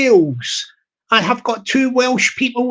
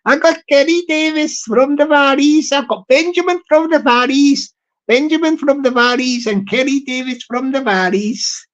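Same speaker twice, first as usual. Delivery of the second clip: hammed-up Welsh accent, higher at the start; 3 semitones higher than usual. Shown as a demonstration. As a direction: have a shaky go at a Welsh valleys accent. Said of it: "I can't really do the Welsh accent".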